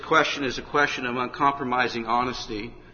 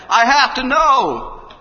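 high, loud, slow cry of need; a man at his wits' end crying out; desperate plea; 13 semitones higher, 13 dB louder, much slower than usual. Acted. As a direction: beg the preacher, slowly and loudly.

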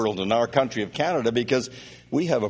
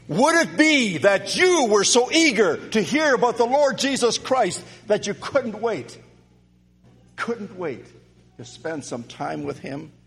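preaching; 6 semitones higher than usual. Acted. Preacher urging his congregation, fervent preaching.

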